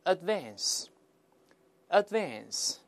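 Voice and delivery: slow American "advance" with flat "a" as in cat; American pronunciation. Shown as a demonstration. Teacher leads students in another repeat, American pronunciation. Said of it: "美音就好读多了".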